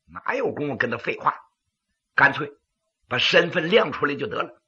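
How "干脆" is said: ordinary delivery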